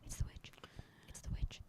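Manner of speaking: whispered warning to friends; soft, secretive whisper; hushed whisper. Real, not acted. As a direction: whisper it under the breath. Spooked students whispering about witch.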